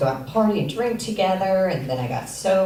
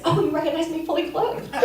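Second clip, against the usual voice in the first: mock casual; 11 semitones higher than usual. Retold awkward reply.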